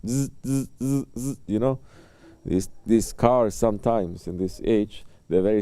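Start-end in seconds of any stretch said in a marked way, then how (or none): 0.1-1.4 buzzing car lane-warning rumble; imitated buzzing. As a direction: buzz like a car's lane-departure warning, softly.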